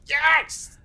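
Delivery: high, loud joyful scream of yes. Retold or imitated. Fan hearing Scotty's name, screaming with delight. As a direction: shriek it out like a thrilled fan. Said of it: "I just screamed".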